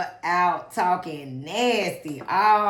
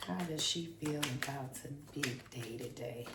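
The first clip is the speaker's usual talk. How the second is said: ordinary delivery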